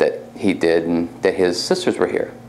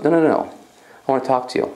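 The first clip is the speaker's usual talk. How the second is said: higher, insistent retold old lady; elderly lady; 6 semitones higher, faster than usual. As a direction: lightly play an old lady stopping someone. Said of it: "an elderly lady".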